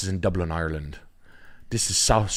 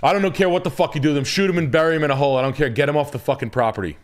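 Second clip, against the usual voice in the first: higher, louder, fast mock rant; 7 semitones higher, 3 dB louder, faster than usual. Real, not acted. Streamer ranting to co-host about dumping horses, fast and loud. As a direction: rattle it off gleefully.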